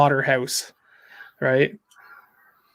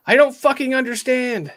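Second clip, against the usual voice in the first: mock scream; 8 semitones higher than usual. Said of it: "[name] screams".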